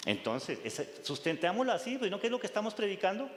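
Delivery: ordinary delivery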